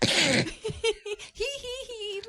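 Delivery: high-pitched hee-hee; high giggle. Real, not acted. Co-host thanked for a great job, giggling. Giggles after being complimented.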